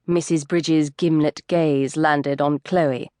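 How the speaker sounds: usual speaking voice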